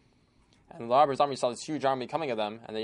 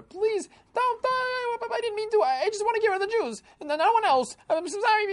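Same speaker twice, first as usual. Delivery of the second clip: very high, louder, pleading for his life; desperate begging; 6 dB louder than usual. Acted. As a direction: beg the governor not to hang you. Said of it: "started begging the governor".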